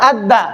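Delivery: अद्दा with a light, thin D; आराम से. Shown as a demonstration. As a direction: say अद्दा gently, unrounded. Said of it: "महीन आवाज करेंगे". (अद्दा को आराम से, महीन)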